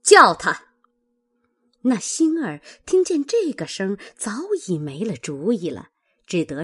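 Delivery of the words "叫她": loud, harsh order; stern sharp command. A narrator's reading. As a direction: bark it like a furious mistress. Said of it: "凤姐儿厉声道".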